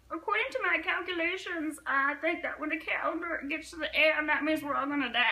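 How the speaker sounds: mock scientist prediction. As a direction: play a know-it-all scientist making calculations. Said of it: "there was a scientist along the way".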